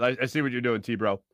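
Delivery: ordinary talk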